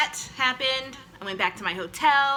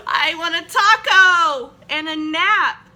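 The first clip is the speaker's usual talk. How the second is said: whiny crying plea; 5 semitones higher, 10 dB louder, slower than usual. Retold or imitated. Her answer through tears.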